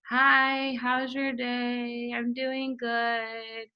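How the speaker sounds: slow, soft, dragging greeting with no excitement; mock a lifeless voice message, very slow and quiet; bored flat voice note. Shown as a demonstration. Showing how a dull voice note sounds to a prospect, bored and slow. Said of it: "there's no excitement in your voice".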